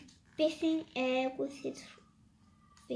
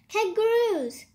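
excited; 7 semitones higher, 8 dB louder than usual. A narrator's reading. Noah reads from animal book.

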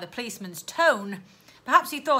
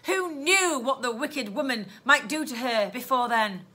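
ordinary delivery

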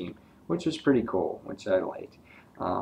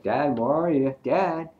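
raised, higher call into an empty house; calling out for dad; 6 semitones higher, 4 dB louder than usual. Retold or imitated. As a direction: call out for Dad, a bit worried. Son calls for missing dad.

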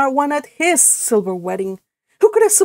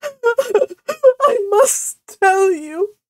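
high, very slow words broken by sobs; hammed-up blubbering wail; heavy sobbing; 12 semitones higher, 4 dB louder, much slower than usual. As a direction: sob so hard the words barely get out. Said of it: "she sobbed".

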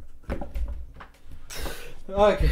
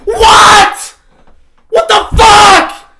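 shrieking disbelief, far higher and louder, slow; stunned screaming; 10 semitones higher, 10 dB louder, slower than usual. Real, not acted. Streamer screaming at chat over a fifty dollar donation. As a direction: scream like you can't believe it.

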